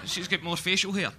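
ordinary talk, nothing marked

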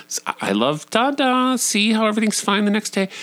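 high, exaggerated lovey-dovey display; showy affection. Parent showing Jackson all is fine, exaggerated affection. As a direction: imitate parents overplaying fondness for the kid.

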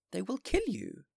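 high, loud alarmed warning; frightened mayor. Acted. Mayor warning the bishop, high and loud. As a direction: cry out the danger.

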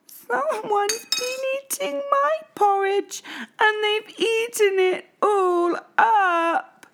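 put-on very high, slow, tiny baby bear voice; Baby Bear crying out. A narrator's reading. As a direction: wail it like a tiny upset cub. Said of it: "cried Baby Bear".

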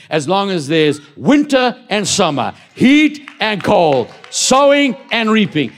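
loud, high, rising sermon cry; thundering pulpit proclamation; full-force preaching. Acted. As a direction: preach it out, building with conviction.

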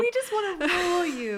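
high, pleading mock whine; hammed-up petulance; mock British whine. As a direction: whine like a thwarted ruler. Listener joins mocking British rulers.

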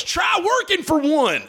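high, slow mock shout; yelled heckle from the crowd; heckler shout. Comic telling a club story, mock-shouting a heckler's line. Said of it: "a guy goes".

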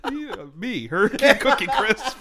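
giggling through the words; laughing. Real, not acted. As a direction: still cracking up. Laughing fit continues.

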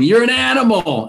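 high-pitched imitation of a yelling neighbor; mock yell. Neighbor shouting at the man next door, mock yell. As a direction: mildly yell it, pitched right up. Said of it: "there's a neighbor yelling".

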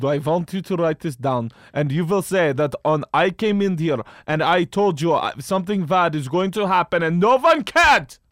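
put-on insistent Russian-tinged accent, demanding they write it down; Lee Harvey Oswald. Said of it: "it was exactly what Lee Harvey Oswald sounds like".

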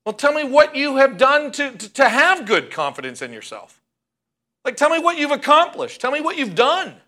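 imitating a blunt advice host pressing the caller; Doctor Laura's challenge. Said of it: "you know what she said to her".

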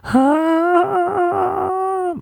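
very high shriek of pain; a piercing yelp as the door slams on the fingers. Retold or imitated. Boy whose fingers are slammed in a door, high pained shriek. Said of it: "I reached [name] notes in the pain".

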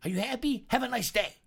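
a hint of a fed-up passenger; snippy passenger. Passenger brushing off the stewardess, high and sarcastic. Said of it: "And he goes".

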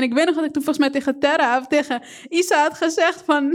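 normal talking voice

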